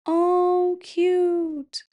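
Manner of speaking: high, drawn-out coo. Real, not acted. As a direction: melt over something adorable, up high. Reacting to the cute cartoon.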